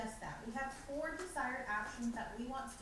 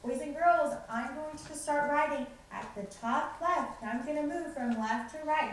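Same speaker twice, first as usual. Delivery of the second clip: teacher narrating; 4 semitones higher, 6 dB louder than usual. Shown as a demonstration. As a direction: explain each move to the class. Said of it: "you're gonna say".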